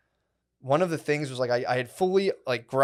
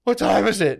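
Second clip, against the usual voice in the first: eager question; 8 semitones higher, 6 dB louder than usual. Retold or imitated. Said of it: "he'd come up and be like".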